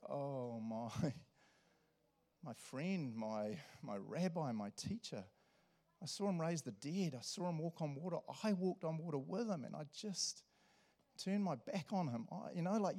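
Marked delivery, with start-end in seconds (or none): 0.1-5.2 slow, soft lament; heavy-hearted and halting, barely getting it out; grieving Peter. Acted. Peter waking up full of regret, grieving. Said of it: "it would just be like". 6.0-12.3 soft, higher-pitched anguished regret; aching disbelief at their own betrayal; destroyed. Acted. Peter mourning that he turned his back, destroyed. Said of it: "you just feel destroyed".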